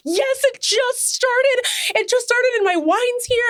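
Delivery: high, slow and gushing with delight; excited. Retold or imitated. Telling the wine delivery woman, giddy and high. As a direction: squeal it out, thrilled the movie just started. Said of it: "And I'm, like, so excited".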